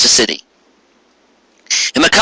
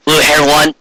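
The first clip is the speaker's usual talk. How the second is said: plain reading voice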